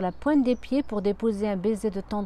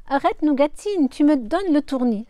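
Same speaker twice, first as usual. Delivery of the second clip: loud, smiling mock complaint; complaining with a smile; 5 semitones higher, 7 dB louder than usual. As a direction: protest while grinning. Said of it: "se plaint-il en souriant". (plainte amusée, plus forte)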